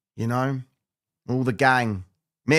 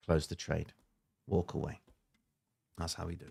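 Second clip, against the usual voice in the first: out of breath; 5 semitones lower, 12 dB quieter than usual. Real, not acted. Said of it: "I'm out of breath now".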